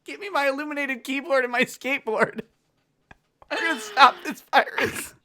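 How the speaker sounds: hammed-up urgency; mock action hero. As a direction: demand it like a movie hacker. Playing an action-movie hacker.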